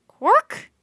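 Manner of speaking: very high, loud croak; raven croak. A narrator's reading. Raven lifting off the ground, very high loud croak. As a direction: squawk it like a raven taking off. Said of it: "he croaked".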